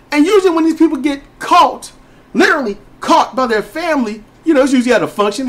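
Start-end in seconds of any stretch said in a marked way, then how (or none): none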